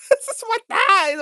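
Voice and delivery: high, laughing protest. Retold or imitated. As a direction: giggle through the words in a high voice. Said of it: "I was like".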